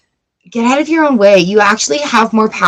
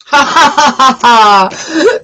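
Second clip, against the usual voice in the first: playful laugh; 3 semitones higher, 3 dB louder, slower than usual. Real, not acted. Playful laugh at next sign.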